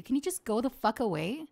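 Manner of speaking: ordinary delivery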